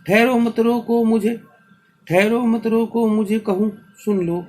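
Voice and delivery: high, loud declaimed verse; insistent chanted appeal to be heard; impassioned poem recitation. Acted. Poem's speaker demands to be heard.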